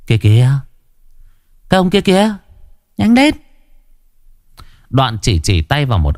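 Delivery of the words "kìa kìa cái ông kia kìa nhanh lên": put-on high-pitched urging boy voice; urging friend. A narrator's reading. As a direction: hurry your pal along in a high voice. Friend urgently points out a customer.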